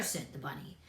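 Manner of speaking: ordinary delivery, nothing marked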